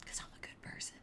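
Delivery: whispered taunting aside; smug whisper. Retold or imitated. David taunting Abner with a smug whispered aside. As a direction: whisper it smugly as a dig. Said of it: "totally taunts him and teases him".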